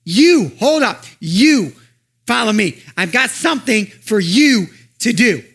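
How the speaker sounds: high, loud call to stop and listen; pleading voice calling someone back; urgent calling. Acted. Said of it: "And he's saying".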